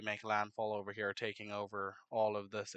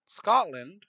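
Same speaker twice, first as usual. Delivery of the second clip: higher, louder burst on Scotland; excited; 8 semitones higher, 9 dB louder than usual. Real, not acted. Narrator hyping up the Scottish fleet, loud and excited. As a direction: announce it with a jolt of energy. Announcing the next nation.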